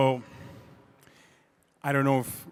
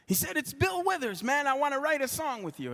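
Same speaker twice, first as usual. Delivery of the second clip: high, quick [name] imitation; 12 semitones higher, 4 dB quieter than usual. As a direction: lift the voice way up to play Bill.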